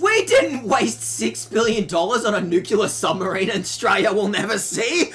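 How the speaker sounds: ordinary delivery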